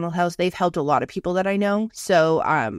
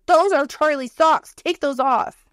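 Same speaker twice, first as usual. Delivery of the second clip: high, slower, louder mock outrage; hammed-up scandalised scolding; wife busting husband in daughter's socks; mock outraged order; 8 semitones higher, 4 dB louder, slower than usual.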